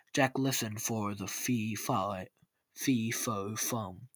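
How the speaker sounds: ordinary delivery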